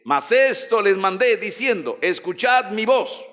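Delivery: high, declamatory preacher's reading; scripture proclamation. Acted. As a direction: proclaim each phrase to the congregation.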